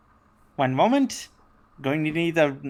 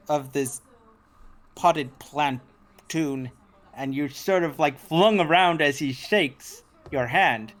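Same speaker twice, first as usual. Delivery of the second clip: ordinary delivery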